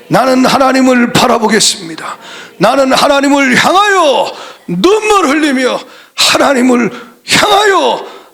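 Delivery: high, pressing sermon voice rising to a cry; a preacher voicing Job's tearful vow to the congregation; impassioned preaching. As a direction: build the vow to a peak.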